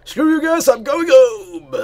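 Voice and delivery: high, loud gleeful yell; triumphant shout. Real, not acted. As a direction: yell it defiantly. Quoting mock exit.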